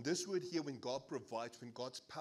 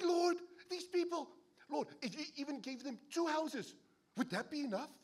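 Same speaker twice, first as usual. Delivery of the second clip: exasperated plea; 12 semitones higher, 4 dB louder than usual. Retold or imitated. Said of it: "And you're like".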